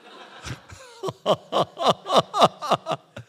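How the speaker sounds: long burst of loud laughter. Real, not acted. Preacher laughing at his own anecdote, loud laugh.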